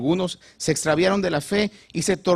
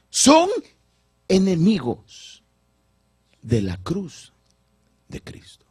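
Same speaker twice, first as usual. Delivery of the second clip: imitating voice breaking; 2 semitones higher, 3 dB louder, slower than usual. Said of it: "se le quiebra la voz".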